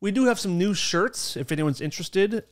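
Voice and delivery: loud upbeat promo read; pitching merch to listeners; announcer voice. Real, not acted.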